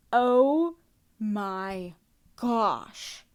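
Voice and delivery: a drawn-out, stunned oh my gosh; real shock at the scene; shocked exclamation. Real, not acted. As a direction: gasp it out in disbelief.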